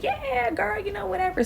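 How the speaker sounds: high, airy mock voice of the trainer; breezy trainer imitation. Trainer brushing it off, high and airy.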